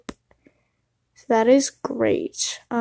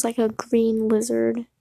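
usual speaking voice

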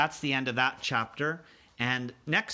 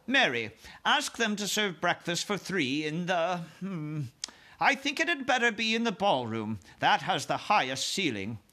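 higher, gracious royal instructions; composed Queen; 5 semitones higher than usual. Acted. Queen instructs her maid about breakfast, composed. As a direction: give orders politely, pausing to consider.

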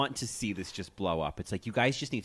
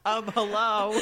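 high, loud catchphrase mimicry; Angelina; 13 semitones higher, 7 dB louder than usual. Repeating a favourite tagline for co-hosts, high and loud. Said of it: "my favorite is Angelina's".

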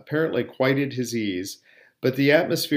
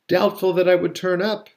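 raised, knowing suggestion; shrewd; 7 semitones higher, 5 dB louder than usual. A narrator's reading. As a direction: offer it as a canny guess. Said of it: "suggested shrewdly".

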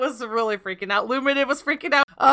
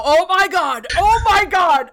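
rising, louder imitated alarm; mock panic; 6 semitones higher, 4 dB louder, slower than usual. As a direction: echo a teammate freaking out. Teammate panicking retold.

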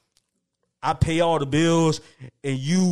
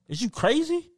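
incredulous; 9 semitones higher than usual. Real, not acted. Incredulous reaction to paying bills.

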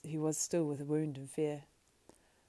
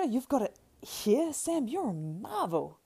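high, loud, drawn-out amazement; amazed gasping joy; 8 semitones higher, 8 dB louder, slower than usual. Acted. Frodo hears Sam saved the Ring, amazed delight. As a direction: marvel at him, slow and elated. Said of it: "gasped Frodo".

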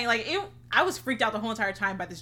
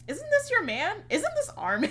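high, louder disbelieving question; incredulous; 12 semitones higher, 6 dB louder than usual. Retold or imitated. To Fate about Gemini holding best friend, high incredulous. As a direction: ask it in high, loud disbelief. Retold shock, asking Fate.

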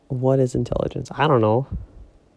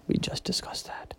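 very soft, voiceless whisper; whispered aside; 12 dB quieter than usual. Pretend. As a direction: drop to a conspiratorial whisper. Playful whispered aside to listeners.

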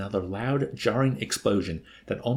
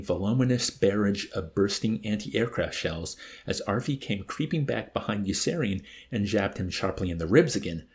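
plain reading voice

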